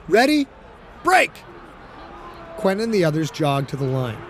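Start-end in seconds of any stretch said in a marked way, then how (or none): 0.1-1.3 huddle call. Acted. Calling huddle break.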